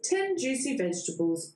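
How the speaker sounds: ordinary delivery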